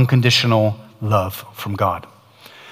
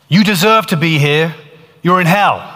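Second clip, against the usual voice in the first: condemning voice; 4 semitones higher than usual. Retold or imitated. Hell voice telling Ian he belongs there, raised and cold. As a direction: pronounce his sentence.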